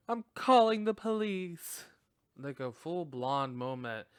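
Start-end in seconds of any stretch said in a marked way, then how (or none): 0.1-1.8 high, loud frightened call into the dark; scared girl calling out. Retold or imitated. Girl alone at home calls out to the noise, frightened. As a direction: call out nervously to an unseen intruder. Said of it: "and then she goes".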